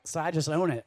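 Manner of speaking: normal talking voice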